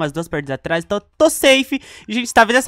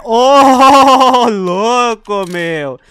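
loud, laughing outburst of disbelief; amazed laughing yell; 3 semitones higher, 7 dB louder, much slower than usual. Real, not acted. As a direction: blurt it out in shock with a laugh. Stunned reaction while playing.

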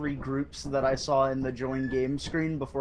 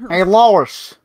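loud, high Peter Griffin voice; shouted cartoon dad voice; 4 semitones higher, 14 dB louder than usual.

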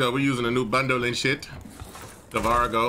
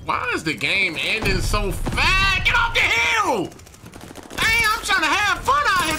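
frustrated shout. Real, not acted. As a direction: shout it at the screen, fed up. Game ending, shouting at players.

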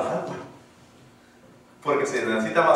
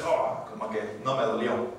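shrugging it off; 5 semitones lower, 7 dB quieter than usual. Retold or imitated. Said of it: "usted simplemente dice".

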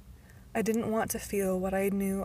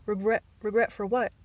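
surprised; 6 dB louder than usual. A narrator's reading. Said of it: "caught him off guard".

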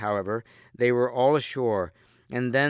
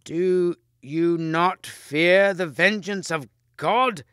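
high, loud and slow solemn warning; old archbishop crying out in warning; stern cry; 7 semitones higher, 5 dB louder, much slower than usual. A narrator's reading. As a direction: call down God's vengeance, slowly. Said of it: "he cried".

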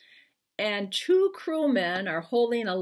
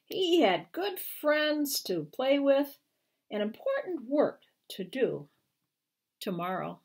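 slow, lilting storybook read-aloud; gentle picture-book narration with lifted pitch; reading to children; 6 semitones higher than usual. Acted. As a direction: read it like bedtime to little kids. Author reads picture book aloud.

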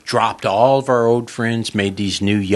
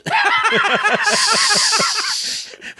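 high, uproarious burst of laughter; real high belly laugh; 4 dB louder than usual. Real, not acted. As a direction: laugh hard at the punchline. Cracking up after the punchline.

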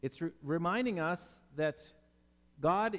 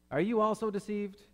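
higher-pitched incredulous retort; mock indignation; 6 semitones higher, 4 dB louder than usual. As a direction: play an affronted Pharisee, hammed up lightly. Pharisees' scornful reply, acted.

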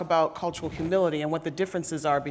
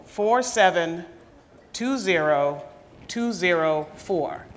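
slow and clear; slower than usual. Shown as a demonstration. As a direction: spell out each digit slowly. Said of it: "go to menti dot com".

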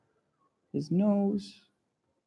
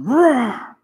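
loud, higher mock lion roar; 5 semitones higher, 5 dB louder than usual. Lion roaring at his reflection, playful roar. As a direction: roar down the well like a storybook lion. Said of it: "He yells at the lion".

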